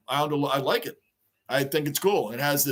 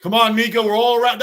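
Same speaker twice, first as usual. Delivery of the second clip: urging the player; 5 semitones higher, 6 dB louder than usual. Real, not acted. Yelling at the game on TV.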